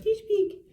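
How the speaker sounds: high mock peeping sound, rising on the second peek; imitating shadow noise. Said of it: "It was like".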